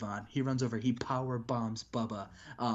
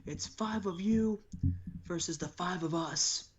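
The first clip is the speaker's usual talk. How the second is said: wrestler promo; 8 semitones higher, slower than usual. Retold or imitated. Raven calling out Hogan, a high angry promo. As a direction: cut a furious promo at Hogan. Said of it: "He says".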